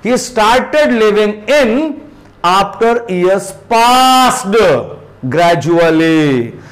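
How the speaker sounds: very slow, word by word, in stressed; deliberate slow classroom read; slow model reading. Shown as a demonstration. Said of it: "So come on here now".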